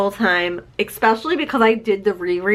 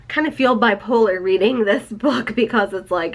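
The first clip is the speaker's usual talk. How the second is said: ordinary talk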